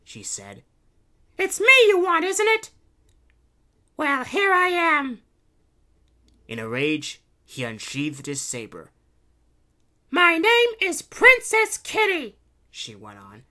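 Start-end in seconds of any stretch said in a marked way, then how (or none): 1.4-5.2 very high, loud heroine voice; defiant princess. A narrator's reading. Princess Kitty confronting the Baron, defiant princess. 10.1-12.4 very high, loud, slow heroine; proclaiming princess. A narrator's reading. Princess Kitty naming herself to the Baron, proclaiming.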